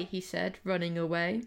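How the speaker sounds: usual speaking voice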